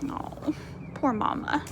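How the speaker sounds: hushed, pitying sigh of a line; soft breathy "poor Mama"; sympathetic whisper. Real, not acted. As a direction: whisper it with pity for the mother. Reader sympathizes with mother.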